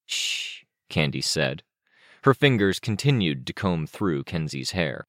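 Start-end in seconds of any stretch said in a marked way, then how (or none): 0.1-0.6 soft whispered shush; hushing. Acted. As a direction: gently shush a friend. Candy hushes Kenzie's apology.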